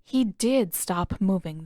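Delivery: plain reading voice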